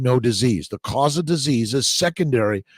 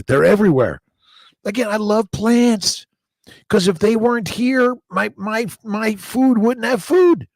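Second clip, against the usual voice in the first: playful high voice; 9 semitones higher, 4 dB louder than usual. Pretend. Joking about plants on the livestream.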